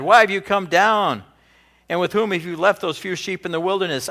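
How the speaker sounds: a big brother's sharp rebuke; angry brother. Retold or imitated. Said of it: "Eliab's anger was kindled against David".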